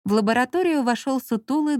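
usual speaking voice

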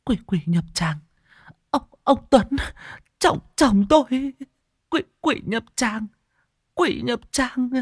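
very high, broken, stammering fright; breathless stammering. Acted. Wife babbling about the demon as she leads them, very high. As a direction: stutter out the words, breath failing. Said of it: "nói ú ớ chẳng ra hơi".

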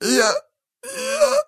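very high, squeezed stammer; choked wheeze. A narrator's reading. Captive being choked by the slayer, high wheeze. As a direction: stammer in a strangled high squeak. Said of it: "просипел мужчина".

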